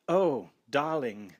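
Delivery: slow, drawn-out British film voice; a young effeminate Englishman swooning. A narrator's reading. Old movie actor to his lover, in a slow British drawl. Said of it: "Young, male, slightly effeminate, British".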